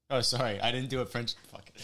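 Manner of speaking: ordinary delivery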